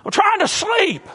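high, loud yell of a grumpy prisoner; angry prisoner. Retold or imitated. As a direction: bark it at the singers next door. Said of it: "prisoners down there saying".

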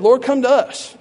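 high, loud mock summons; acted disciple's shout for help; calling out. Alternative cry, acted out.

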